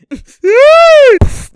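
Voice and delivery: a sudden high, loud laughing howl; loud laughing shriek. Real, not acted. Erupts watching the play.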